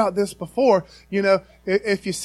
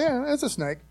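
higher-pitched, offhand observation; note it as nothing special; casual remark; 6 semitones higher, 3 dB quieter than usual. Acted. Friend shrugging off just a snake, casual remark. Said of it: "if it's just a snake, we'll say".

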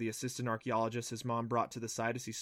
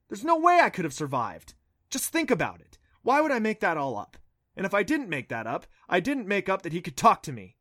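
heated insisting; 6 semitones higher, 7 dB louder than usual. A narrator's reading. Adam heatedly argues with Clancy.

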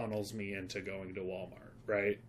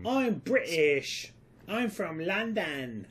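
higher, slow, hammed-up mock British accent; fake British accent; 6 semitones higher, 4 dB louder, slower than usual. Needling the co-host until he says stop, slow mock British accent. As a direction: drag it out in a bad British accent to annoy. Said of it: "Stop, stop".

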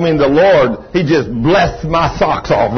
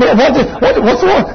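high, stammering bewildered ask; higher flustered what's wrong; flustered question; 10 semitones higher, 3 dB louder than usual. Retold or imitated. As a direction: fumble for words in surprise. Confused man questions hugging stranger.

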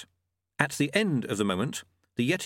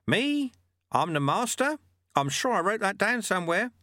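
high, questioning monk voice; Lutze, mock-innocent master; 9 semitones higher than usual. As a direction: feign surprise at being questioned.

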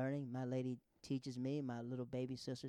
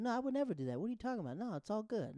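protesting innocence; 7 semitones higher, faster than usual. Acted. Acting out his protest.

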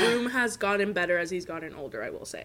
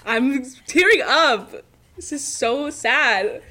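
fake tearful; 3 semitones higher, 6 dB louder, slower than usual. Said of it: "Are you trying not to laugh?".

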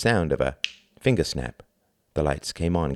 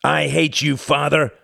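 higher and louder shout; 9 semitones higher, 5 dB louder than usual. Acted. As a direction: fling it out at his father before the shot. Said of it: "shrugged and shouted out".

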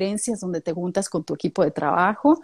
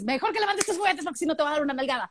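quicker, flatter scolding; 6 semitones higher, 6 dB quieter, faster than usual. Retold or imitated. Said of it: "A que le digas".

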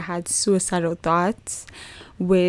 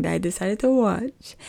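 normal talking voice

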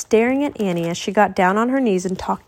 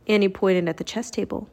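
usual speaking voice

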